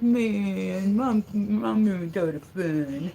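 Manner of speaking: slow, drawn-out dog voice; put-on voice of a disappointed pup; complaining puppy. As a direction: voice the dog grumbling about Mom. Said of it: "He's like".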